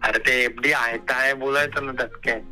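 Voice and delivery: usual speaking voice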